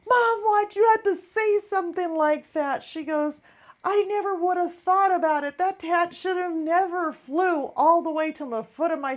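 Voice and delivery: high, loud imitation of an upset daughter; protesting daughter. Daughter scolding mom over the hat, upset protest.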